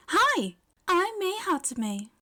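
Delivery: high, slow, overeager hello; eager greeting. A narrator's reading. May introducing herself to Yaoyorozu, high and eager. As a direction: greet her much too brightly.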